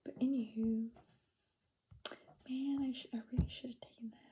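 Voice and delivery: whispering. Real, not acted. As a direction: whisper it late at night. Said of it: "I'm using my whisper voice".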